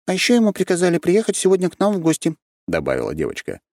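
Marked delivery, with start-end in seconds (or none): 0.1-2.4 lightly voiced young girl; high girl voice. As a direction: speak up high like a small girl. Girl voice continues.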